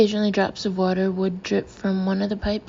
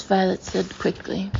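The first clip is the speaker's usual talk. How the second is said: ordinary delivery, nothing marked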